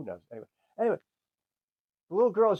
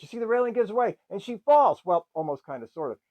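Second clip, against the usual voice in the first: ordinary delivery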